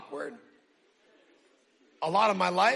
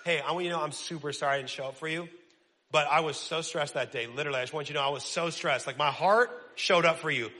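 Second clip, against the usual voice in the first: low, quick, self-justifying mock apology; excuse-laden apology; 7 semitones lower than usual. Friend apologizing for not showing up, mock-defensive. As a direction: rattle off excuses to sound sorry. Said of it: "I do it".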